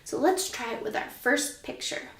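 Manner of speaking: ordinary delivery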